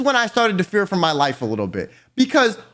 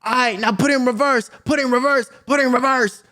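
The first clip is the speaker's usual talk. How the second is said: mock call, pitched higher; calling out instructions; 5 semitones higher, faster than usual. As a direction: imitate an old man calling out directions. Old man calling out towing instructions.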